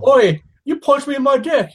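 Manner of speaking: put-on comic British accent, high and loud. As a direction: bark it like an offended London bloke. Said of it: "You've got the best British accent".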